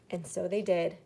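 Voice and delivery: usual speaking voice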